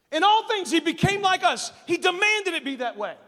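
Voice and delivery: high, loud, insistent preacher; full-force preaching. Real, not acted. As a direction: hammer each phrase out to the congregation.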